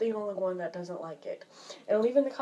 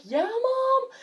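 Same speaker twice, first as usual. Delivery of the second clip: calling back; 7 dB louder than usual. Retold or imitated. Kid calling back to parent.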